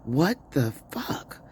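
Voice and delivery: high, slow, stunned "what the"; shocked family reaction. Retold or imitated. Retelling how husband and son reacted, high and stunned. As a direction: act out the family's disbelief, drawn out. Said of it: "my husband and my son are like".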